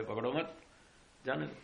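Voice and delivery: "पकड़ो मत" together, a break, then "जाने दो"; pause after मत. Shown as a demonstration. Teacher showing how wrong grouping flips meaning, pausing after "पकड़ो मत". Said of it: "आसक्ति बिगड़ जाएगी तो वाक्यार्थ उलट जाएगा".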